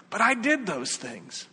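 high, slow, pleading objection; protesting sinner. Acted. Forgiven sinner objects before Jesus, incredulous protest. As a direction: voice lifts in disbelieving protest.

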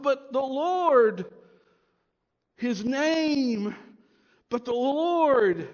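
slow, loud, drawn-out the Lord; emphasis on the Lord. Shown as a demonstration. Pastor proclaiming the divine name, slow and emphatic. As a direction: lean hard on Lord each time. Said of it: "emphatic divine name".

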